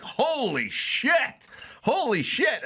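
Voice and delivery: high, drawn-out imitated astonishment; stunned amazement. Friend calls back having seen the news, stunned. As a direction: stretch it out high, as the news sinks in.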